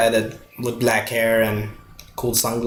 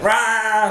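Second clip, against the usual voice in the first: a higher mock roar; imitated dinosaur roar; 8 semitones higher than usual. Showing off the T-Rex, a hammed-up roar. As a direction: give a playful monster roar.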